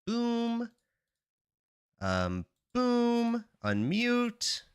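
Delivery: high cartoonish boom; playful high boom. Streamer turning on music, saying boom in a high playful voice. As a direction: pop each word out high and playful.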